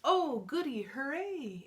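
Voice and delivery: loud gleeful exclamation swooping down; delighted. Acted. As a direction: cheer like a pleased little duckling. Duckling delighted that it rains.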